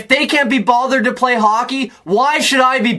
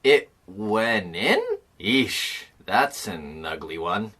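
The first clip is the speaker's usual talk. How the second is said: low, soft, drawn-out dismay; slow, wincing reaction to a bad goal; pained disbelief; 12 semitones lower, 9 dB quieter, slower than usual. Real, not acted. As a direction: let it sink into a quiet cringe.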